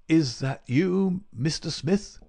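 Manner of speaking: lightly voiced sickbed murmur, drawn out; weak sick man. A narrator's reading. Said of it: "Holmes whispered".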